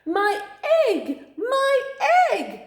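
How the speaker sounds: panicked shriek. Acted. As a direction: shriek like a frantic mother bird. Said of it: "she shrieked".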